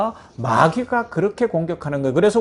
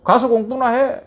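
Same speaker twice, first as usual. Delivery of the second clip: loud, higher mock scolding; scolding mother; 4 semitones higher, 3 dB louder than usual. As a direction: shoo the child off to study. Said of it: "윽박 지르고".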